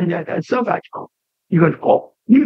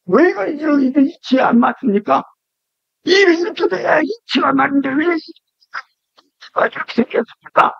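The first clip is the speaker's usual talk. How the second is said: higher, pleading demand for reasons; protesting why, pitched up; questioning complaint; 6 semitones higher than usual. Acted. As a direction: argue with God like a puzzled prophet. Habakkuk questioning God, acted.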